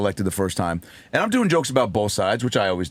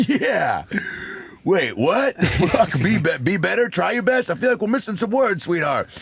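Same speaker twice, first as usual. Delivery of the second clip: high-pitched, incredulous crowd reaction; mock bafflement; 9 semitones higher than usual. Crowd reacting to Melania's slogan, baffled and incredulous. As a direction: cheer that turns into puzzled, high questioning.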